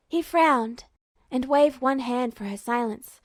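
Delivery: ordinary delivery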